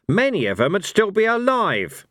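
high, sharp angry retort; angry snap at Blind Io; snapped. Acted. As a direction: bite the words off at the god. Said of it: "snapped Cohen".

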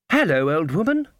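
put-on high, innocent child voice; sweet little girl.

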